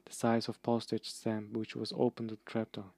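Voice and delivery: ordinary delivery, nothing marked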